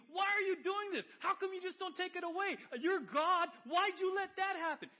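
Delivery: loud, high shouted protest at God; acted furious outburst; angry arguing. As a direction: hurl the questions like an accusation. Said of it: "instead of being angry, instead of arguing".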